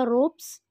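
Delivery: रोप्स with a clear final s, "rops"; teacher modelling रोप्स ending in a crisp s; correct pronunciation. Shown as a demonstration. Teacher showing students the right sound, careful pronunciation. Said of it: "सही pronunciation होगा".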